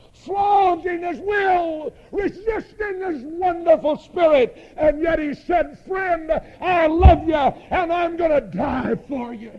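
high, loud revivalist cry; full-voiced fervent proclamation; impassioned preaching. Real, not acted. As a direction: build to a ringing shout from the pulpit. Preacher builds to full force.

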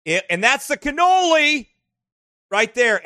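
loud, high burst of food delight; excited gushing. Real, not acted. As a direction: exclaim over a favorite dessert. Gushing over food in photos.

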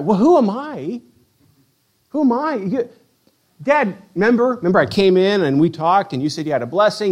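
high-pitched, flustered disbelief; acted exasperated bewilderment; incredulous protest. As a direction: plead with a forgetful dad to remember. Esau stunned father doesn't know him.

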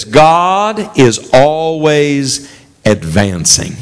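very slow, savoring each word; slow and solemn. Retold or imitated. Quoting Hudson Taylor's motto, slow and solemn. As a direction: lay the phrase down like a creed. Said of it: "Hudson Taylor said this".

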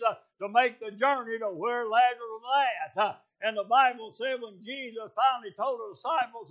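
high, loud revival sermon, building to a shout; full-force preaching. Acted. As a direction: preach it hard, rising in pitch and volume to a peak.

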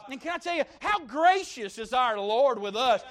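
ordinary talk

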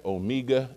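"omega" said oh-MEE-ga; "omega" with a long EE in the middle; second pronunciation. Shown as a demonstration. Pastor gives the congregation oh-MEE-ga. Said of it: "three ways you can pronounce it".

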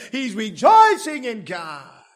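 climbing to a shouted, high revival cry; preaching at full force. Acted. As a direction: preach it hotter and hotter until you're shouting.

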